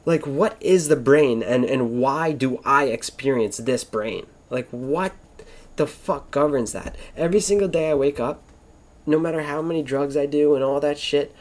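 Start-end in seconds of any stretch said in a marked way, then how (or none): none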